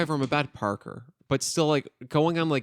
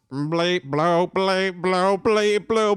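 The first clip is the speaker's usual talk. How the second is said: mock robot beeping and binary; 6 semitones higher, 4 dB louder than usual. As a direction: chant ones and zeros like a robot. Pretends to be a robot.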